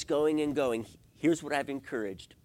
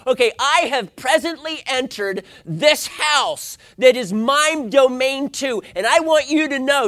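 mock indignant announcement; 11 semitones higher, 10 dB louder than usual. Angry spouse announcing they have entered the house, mock-indignant. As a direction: hammer out each word with hammed-up anger.

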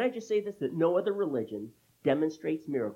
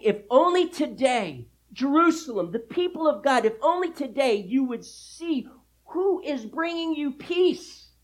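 loud, high, impassioned proclamation; prophetic cry; 11 semitones higher, 9 dB louder than usual. Acted. Preacher voicing a prophetic word over Jerusalem, loud high cry. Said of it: "he gives a prophetic word".

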